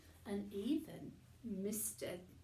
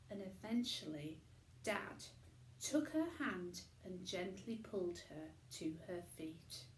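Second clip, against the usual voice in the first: plain reading voice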